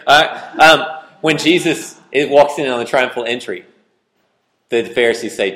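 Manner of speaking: ordinary delivery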